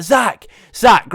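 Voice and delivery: high, loud repeated name; acted alarmed calling. Friends freaking out call to unresponsive Zach, acted alarm. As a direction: call out urgently, re-enacting the panic.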